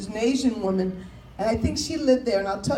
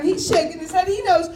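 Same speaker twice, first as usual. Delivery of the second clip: usual speaking voice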